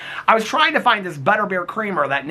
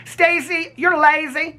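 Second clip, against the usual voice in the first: mock scolding; 9 semitones higher, slower than usual. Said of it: "I'ma yell at everybody".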